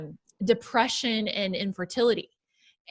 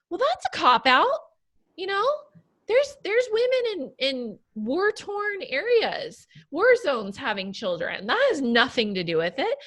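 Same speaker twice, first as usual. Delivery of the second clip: doctor voice; 7 semitones higher than usual. Retold or imitated.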